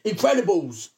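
punchy one-word outcry; mock exclamation. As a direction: belt out the title in mock exasperation. Mock exclamation of the film title.

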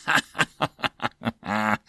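string of short chuckles; staccato acted laughter; amused laugh. A narrator's reading. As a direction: laugh out at an innocent mistake. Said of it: "Joseph laughed".

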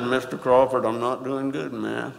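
a hint of a teen talking without opening his mouth; mumbling teen. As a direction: mumble it like shy Levi. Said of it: "Levi talked like this".

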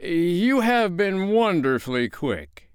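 high, slow, drawn-out mock courtesy; vindictive politeness. A narrator's reading. Father retorting to Wendy, vindictively polite. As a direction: draw out the praise with spiteful politeness. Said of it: "with a vindictive politeness".

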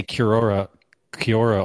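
Kiora as "kyor-OR-uh" or "kyor-uh"; mistaken pronunciation of Kiora. Shown as a demonstration. Player showing what they wanted to say, mistaken Kiora. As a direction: demonstrate the wrong Kiora, with OR in place of ee. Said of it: "I was wanting to say Kiora".

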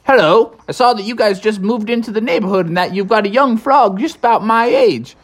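lightly voiced cheerful beaver; friendly beaver. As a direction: rattle off a friendly greeting. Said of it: "the beaver fellow said".

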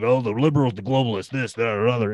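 hammed-up conspiracy rant; mock Alex Jones rant. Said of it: "all over the place".

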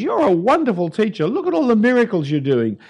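fawning Nicodemus. Retold or imitated. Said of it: "Nicodemus turns up to Jesus and says".

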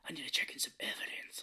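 fast whispered gruff imitation; whispered, quick gruff cop voice; Bale cop voice. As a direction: rasp it quickly like a grim cop. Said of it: "where he's like".

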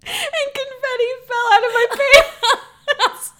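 high, loud, squealing through laughter; helpless laughter. Real, not acted. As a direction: barely get the words out for laughing. Cracks up telling embarrassing story.

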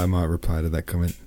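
ordinary delivery, nothing marked

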